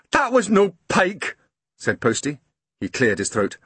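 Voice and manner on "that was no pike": high, breathless Scots voice, pouring out the story; dripping postman tells Grumble his boat sank, high and upset; shaken Scottish postman. Acted. Postman telling of his sunk boat, pitched high with alarm.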